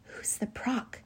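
hushed whispered question. A narrator's reading. Startled Lindy asking the professor who it is, whispered. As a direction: whisper it very softly. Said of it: "she whispered".

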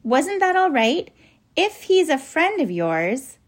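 put-on high, slow worried child's question; anxious. A narrator's reading. Lindy asking if she did wrong, anxious. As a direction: ask it anxiously, pitched high and drawn out. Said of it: "beginning to feel anxious".

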